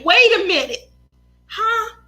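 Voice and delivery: a lightly voiced puzzled dad; mock baffled stalling; half-hearted puzzled dad voice; imitating dad. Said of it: "my brother's favorite line".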